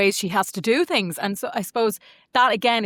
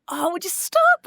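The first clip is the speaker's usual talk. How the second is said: high playful "would you stop"; mock exasperated friend; 10 semitones higher, slower than usual. Friend butting in with a high mock scold. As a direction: tease them like an old friend, high-pitched.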